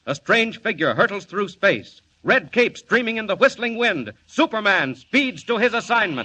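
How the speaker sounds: radio narrator hyping the hero's entrance; rising excitement. A narrator's reading. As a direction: announce the hero soaring in. Narrator builds Superman's flight.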